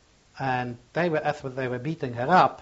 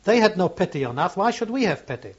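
high, louder, furious retort in the women's voices; a raised, anguished protest; bitter outcry; 9 semitones higher, 8 dB louder than usual. Retold or imitated. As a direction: fling it back, nobody left to spare. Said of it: "They say".

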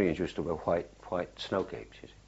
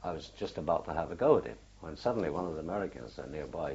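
ordinary talk, nothing marked

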